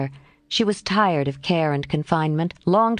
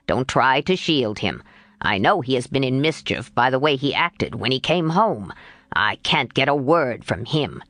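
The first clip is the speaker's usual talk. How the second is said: put-on lower, stern gruff old man; a gruff grandfather demanding answers; 3 semitones lower than usual. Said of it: "Mister Lawrence's gruff voice".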